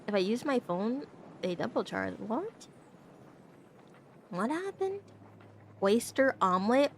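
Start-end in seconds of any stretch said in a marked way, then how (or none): 2.2-5.0 high, soft puzzled question; confused what. Real, not acted. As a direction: ask in baffled surprise. Confused by chat message.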